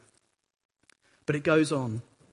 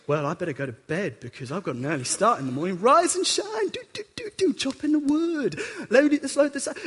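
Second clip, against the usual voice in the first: chirpy and much higher, up and at it; breezy cheerful Abraham; 7 semitones higher than usual. Acted. Abraham cheerfully preparing for the journey, chirpy and bright. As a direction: hum happily through the chores.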